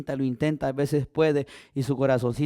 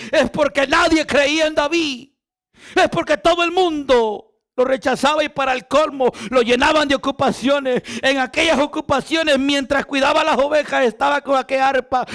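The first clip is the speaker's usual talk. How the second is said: higher, louder preaching build; rising sermon; 9 semitones higher, 6 dB louder than usual. Acted. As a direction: push the congregation toward the climax.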